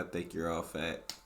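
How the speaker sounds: normal talking voice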